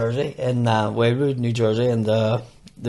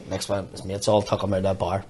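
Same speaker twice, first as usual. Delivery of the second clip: usual speaking voice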